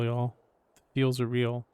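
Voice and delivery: usual speaking voice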